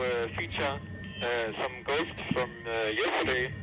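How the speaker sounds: ordinary talk, nothing marked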